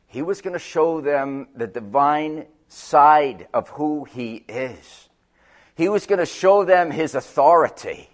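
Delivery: usual speaking voice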